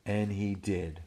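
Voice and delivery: put-on lively read-aloud to children; animated storyteller voice; storybook narration. A narrator's reading. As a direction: tell it big for the little ones. Reading picture book aloud.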